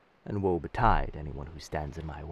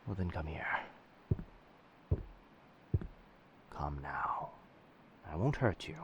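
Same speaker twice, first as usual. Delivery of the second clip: gentle coaxing; 2 semitones lower, 9 dB quieter than usual. Acted. Soothing a frightened, feverish captive.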